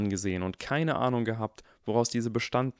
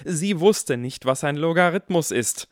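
higher, louder, slow indignant retort; indignant boy; 7 semitones higher, 7 dB louder than usual. A narrator's reading. Harry defends himself to his father, indignant and loud. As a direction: insist it like an offended child.

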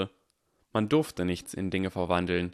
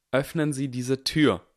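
higher, louder and slow, commanding; firm demand; 6 semitones higher, 4 dB louder than usual. A narrator's reading. Harry demands Snape open the door, commanding. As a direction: say it as a command, not a request.

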